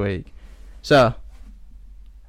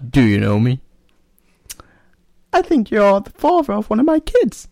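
man doing a woman's voice; 11 semitones higher, 9 dB louder than usual. Acted. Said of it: "So, woman, again".

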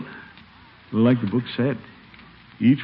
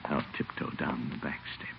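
whisper; 7 semitones lower, 15 dB quieter than usual. Acted. Sneaking out of the hospital.

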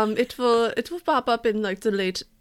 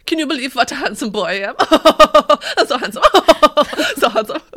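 louder, laughing self-admiring voice; vain laughing boast; 4 semitones higher, 9 dB louder than usual. Acted. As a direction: preen and laugh at your own looks. Voicing vain John Junior.

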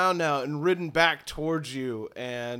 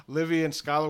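normal talking voice

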